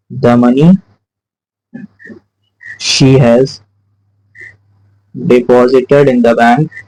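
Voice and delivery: halting phrases with long pauses between; word-by-word dictation. Real, not acted. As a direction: dictate slowly as you write it out. Dictating the answer while writing it.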